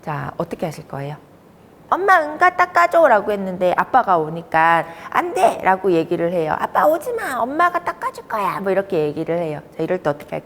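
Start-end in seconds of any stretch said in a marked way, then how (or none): 1.9-3.1 put-on high child's call for mom; 엄마를 부르는 아이. 아이가 엄마에게 부탁하는 장면. 5.1-5.6 put-on high-pitched childish no; 거부하는 아이. 아빠를 거부하는 아이. 6.6-8.6 엄마만 찾는 아이. Retold or imitated. 아빠를 막고 엄마만 찾는 아이.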